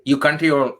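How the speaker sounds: ordinary delivery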